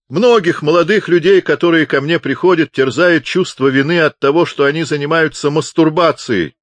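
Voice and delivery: high, loud, booming counsel; loud pronouncement. A narrator's reading. Deaf chaplain counsels the visitor on guilt, loudly. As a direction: pronounce it for all to hear.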